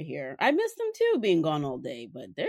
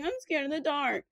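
higher, lightly voiced little girl; imitating her daughter; 9 semitones higher than usual. Mom imitates daughter playing grandma, high mock little-girl plea. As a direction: play up the toddler's pitiful plea. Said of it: "she's like".

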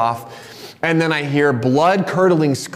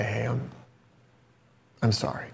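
softer, lower, halting apology; repentant apology; 4 semitones lower, 4 dB quieter than usual. Retold or imitated. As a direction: quietly own up to a child, pausing before the sorry. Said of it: "I had this moment of repentance".

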